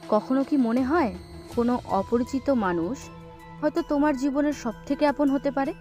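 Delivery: ordinary delivery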